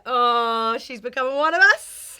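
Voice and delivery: loud, high rising cheer; mock celebration. Host teases guest for turning Londoner, mock-celebratory cry. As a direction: crow it loudly in mock triumph.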